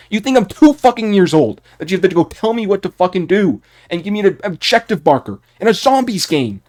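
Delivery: real anger peaking in disbelief; furious outburst. Real, not acted. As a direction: rage at the objective markers. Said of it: "I got pissed off there".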